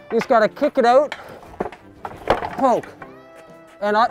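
ordinary talk, nothing marked

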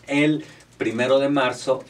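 ordinary delivery

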